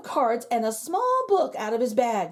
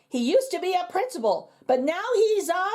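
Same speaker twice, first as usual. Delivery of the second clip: rising higher toward the reveal; building spell; 8 semitones higher than usual. Acted. Magician casts his spell on the principal, rising higher. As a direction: build suspense into the last word.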